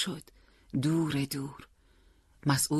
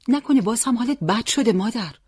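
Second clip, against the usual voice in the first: higher, louder anxious question; worried son; 9 semitones higher, 6 dB louder than usual. A narrator's reading. Son asking mother if she is unwell, worried. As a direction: ask with sudden concern.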